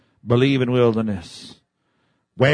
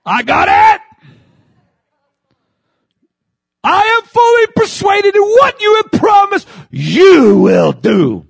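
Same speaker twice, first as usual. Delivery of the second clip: preaching at full force; 10 dB louder than usual. Real, not acted. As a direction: roar it to the rafters. Shouting climax of sermon.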